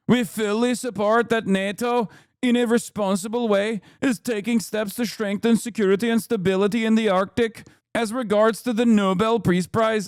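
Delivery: imitated Norwegian accent, reading an official statement; put-on Norwegian accent. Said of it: "that's a perfect Norwegian accent".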